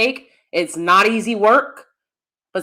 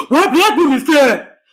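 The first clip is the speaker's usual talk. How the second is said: mocking critics; 5 semitones higher, 5 dB louder than usual.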